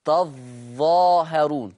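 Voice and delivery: تظاهرون very slow, ظ held and doubled; doubled zah. Shown as a demonstration. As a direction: press the ظ of تظاهرون twice as long. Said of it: "أما ورش يقرأ بتشديد الظاء".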